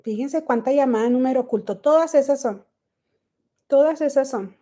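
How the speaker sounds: ordinary delivery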